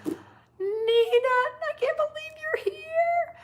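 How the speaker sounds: high, loud, drawn-out squeal of delight; excited greeting. Real, not acted. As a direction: squeal it out slowly after a gasp. Spots an old friend arriving.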